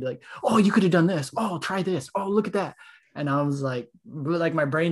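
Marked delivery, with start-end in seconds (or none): 0.4-2.8 put-on higher, eager kid-like gushing; imitating an overeager coach; excited like a kid. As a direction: gush tips like an excited little kid. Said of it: "he turned into, like, a little kid. He was so excited".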